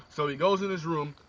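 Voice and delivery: ordinary talk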